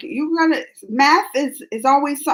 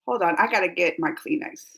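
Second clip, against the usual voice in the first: ordinary delivery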